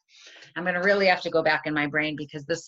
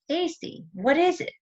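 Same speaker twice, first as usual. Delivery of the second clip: annoyed voice; 11 semitones higher than usual. Retold or imitated. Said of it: "in kind of an annoyed voice".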